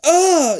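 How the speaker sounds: sharp high scream of fright. A narrator's reading. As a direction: yelp as you try to pull free. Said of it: "you scream as you try to wrench free".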